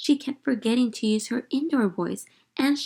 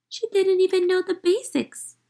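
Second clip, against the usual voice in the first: put-on exasperated child; 11 semitones higher, 5 dB louder than usual. A narrator's reading.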